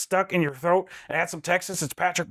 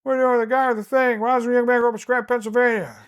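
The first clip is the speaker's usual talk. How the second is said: higher, louder, rushing rambling Biden; Joe Biden impression; 9 semitones higher, 3 dB louder than usual. Shown as a demonstration. Doing Joe Biden for the hosts, higher and rushed. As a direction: pitch up and bluster fast. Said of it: "Or, or Joe Biden".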